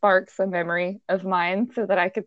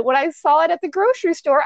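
normal talking voice

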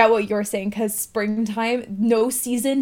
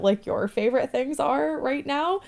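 ordinary talk

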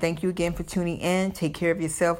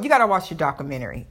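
ordinary talk

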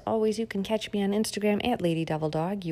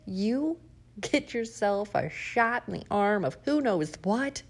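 ordinary talk, nothing marked

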